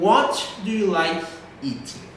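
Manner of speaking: "What do you like eating" falling at the end; falling question intonation. Shown as a demonstration. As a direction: open question "What do you like eating", tune dropping on the last word. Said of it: "a entonação vai lá pra baixo".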